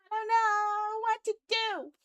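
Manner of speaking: high, slow, drawn-out whine; hammed-up helpless lament; despairing wail. Real, not acted. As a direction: wail it helplessly, holding the vowels. Despairing over broken code.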